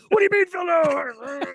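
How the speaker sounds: high, loud mock yell; mock outraged shout. Angry biker blows up at the helper, high mock shout. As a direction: blow up at the question. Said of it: "Uh, no".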